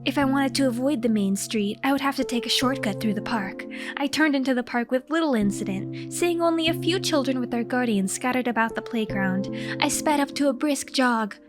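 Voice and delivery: ordinary delivery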